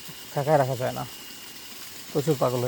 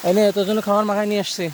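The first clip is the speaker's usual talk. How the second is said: higher, louder coaxing to the animal; coaxing the cow; 6 semitones higher, 5 dB louder, faster than usual. Real, not acted. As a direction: coax the cow to come and eat.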